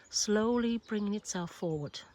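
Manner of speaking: ordinary delivery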